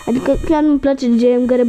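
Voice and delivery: normal talking voice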